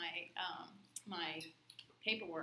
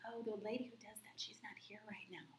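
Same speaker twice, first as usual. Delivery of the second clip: whisper; 2 semitones higher, 8 dB quieter than usual. Retold or imitated. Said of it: "She's like".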